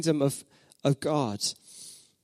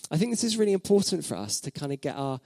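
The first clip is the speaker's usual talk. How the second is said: normal talking voice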